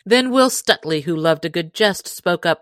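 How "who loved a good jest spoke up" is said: usual speaking voice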